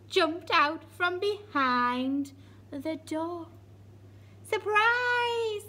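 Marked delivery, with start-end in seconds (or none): 4.4-5.6 high loud shout of surprise. Acted. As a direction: yell it out joyfully. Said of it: "they all shouted".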